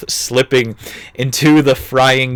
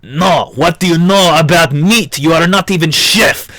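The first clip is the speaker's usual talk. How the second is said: high, loud mock French accent; indignant French boss firing back; hammed-up French accent, pitched up; 5 semitones higher, 4 dB louder than usual. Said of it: "in a French accent".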